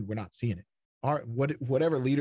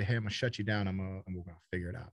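mock careless dismissal; 4 semitones lower, 4 dB quieter than usual. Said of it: "You don't just say".

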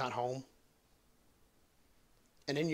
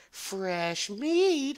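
high ghostly woman's voice, hammed up; imitated woman's scream; 6 semitones higher than usual. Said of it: "hear this woman scream".

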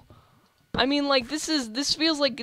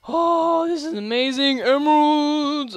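excited shout; 5 semitones higher, 7 dB louder than usual. Real, not acted. Gamer finding emeralds underground, excited shout. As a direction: yell with real delight at the find.

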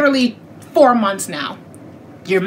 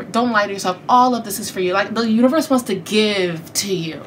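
normal talking voice

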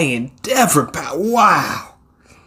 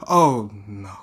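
ordinary talk, nothing marked

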